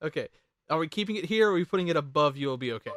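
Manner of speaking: ordinary delivery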